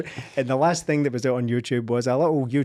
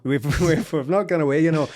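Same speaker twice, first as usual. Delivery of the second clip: jokey, louder mock Indian accent; a quick hammed-up Indian accent, a bit louder and higher; 3 semitones higher, 3 dB louder than usual. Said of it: "I don't know why I did that accent there".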